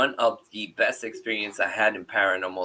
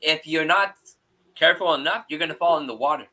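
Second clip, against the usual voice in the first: lightly voiced daughter, higher and insistent; imitating young daughter; 6 semitones higher, 3 dB louder, faster than usual. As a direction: retell your girl's warning, pitched up. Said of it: "She goes".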